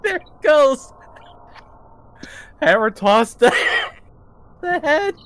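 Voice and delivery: high, loud laughing. Real, not acted. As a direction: laugh the words out. Player laughing at hammer toss.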